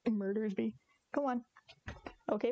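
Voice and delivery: usual speaking voice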